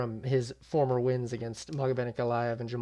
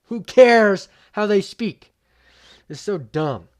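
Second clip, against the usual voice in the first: loud, higher, slow and indignant; indignant shout; 8 semitones higher, 14 dB louder, slower than usual. Real, not acted. Defending Craig's accent to viewers, loud indignant shout. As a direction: yell it in real disbelief. Ranting over the accent mockery.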